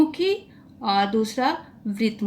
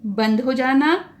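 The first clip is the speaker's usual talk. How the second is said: ordinary talk, nothing marked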